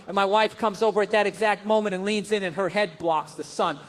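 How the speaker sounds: ordinary delivery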